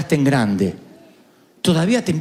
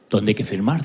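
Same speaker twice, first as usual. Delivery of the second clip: lower, clipped and curt like a stern father; curt dad; 8 semitones lower than usual. Retold or imitated. Dad at the school office, a hint of a cold curt father. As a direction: cut it short, no emotion. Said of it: "Lo cortó así".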